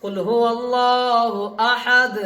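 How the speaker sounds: slow recitation, अहद ending on a bare d; correct stop on अहद. Shown as a demonstration. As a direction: recite slowly and halt on the d of अहद. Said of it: "पढ़ेंगे कैसे सही से". (धीमी तिलावत, अहद पर दाल से रुकना)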